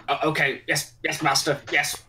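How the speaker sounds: grovelling yes master; hunchback assistant. Retold or imitated. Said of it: "Blaine is like".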